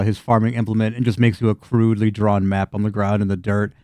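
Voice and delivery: ordinary delivery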